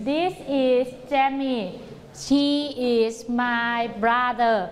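very slow, word-by-word; slow choral drill. Shown as a demonstration. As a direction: chant it slowly together. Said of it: "คนนี้ก็คือ".

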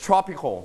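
"Tropical" with the first syllable stressed. Shown as a demonstration. Teacher modelling the word for repeating, first syllable of "Tropical" stressed. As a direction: hit the opening syllable of "Tropical". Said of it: "You stress on the first syllable".

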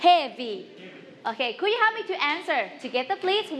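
ordinary talk

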